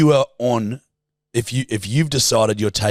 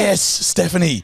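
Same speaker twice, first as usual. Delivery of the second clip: mock sarcastic yes; 7 semitones higher, slower than usual. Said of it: "I go".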